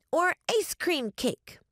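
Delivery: put-on high, eager little-girl listing; child ghost offering treats. A narrator's reading. Child ghost listing cakes for guests, eagerly offering. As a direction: pitch it up as a final offer.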